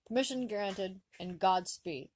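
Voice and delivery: ordinary delivery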